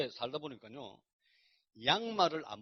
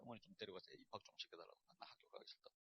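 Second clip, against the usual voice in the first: hushed retold plea; 7 semitones lower, 19 dB quieter than usual. Asking his mother a favour.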